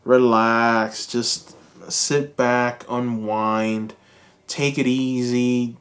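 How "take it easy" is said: ordinary talk, nothing marked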